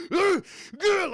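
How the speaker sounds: high, loud effort grunts; hammed-up animal grunting; gorilla-like grunts. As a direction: grunt with each smashing blow. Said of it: "like a gorilla or something like that".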